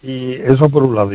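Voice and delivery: ordinary delivery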